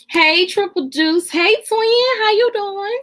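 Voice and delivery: high, sing-song hello; delighted greeting. Real, not acted. Streamer welcoming viewers by name, delighted greeting.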